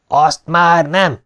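high, loud, drawn-out shout; roared refusal. A narrator's reading. Palmer refusing to wait any longer, roaring. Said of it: "üvöltötte Palmer".

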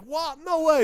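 high, loud mock disbelief; incredulous. Kane Hodder hearing gee bag is worse, high incredulous yelp. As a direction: yelp it out, can't believe it. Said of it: "He's like".

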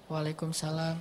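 answering the greeting barely above a breath; reply so softly he cannot hear; hushed reply. Retold or imitated. Companion answers the Prophet's salam very softly on purpose. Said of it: "saya pelankan suara".

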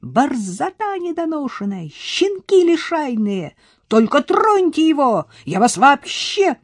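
high, furious tirade; raging scolding. Acted. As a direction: tear into the boys. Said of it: "а она распаляется всё больше". (яростная брань, высоко)

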